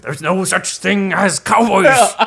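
high, loud, slow blustering uncle; Vernon Dursley. Retold or imitated. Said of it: "han är Vernon Dursley".

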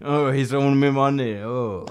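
low-energy, hushed, laboured voice; ailing-throat mafia boss. Retold or imitated. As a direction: imitate a boss who can barely talk. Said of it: "because his throat is fucked up".

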